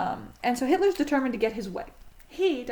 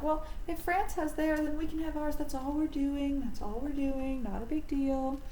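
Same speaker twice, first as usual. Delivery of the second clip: mock innocent excuse; 5 semitones higher than usual. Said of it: "And they're like".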